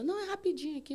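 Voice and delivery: high, breezy dismissive nurse; nurse brushing off. Retold or imitated. Triage nurse brushing off the patient's plea, high and breezy. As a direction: wave her off lightly. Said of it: "Aí ela".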